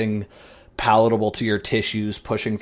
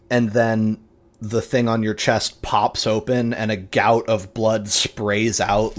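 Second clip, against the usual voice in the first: usual speaking voice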